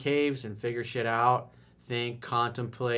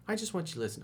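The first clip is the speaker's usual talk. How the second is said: put-on girlfriend voice, higher; 8 semitones higher, 6 dB quieter, much faster than usual. Acted girlfriend answering question.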